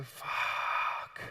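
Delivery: long, unvoiced-style whispered gasp of amazement; drawn-out whisper of disbelief; hushed awe. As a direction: imitate stunned onlookers, breathing the word out slowly. Onlookers stunned by watching live trading.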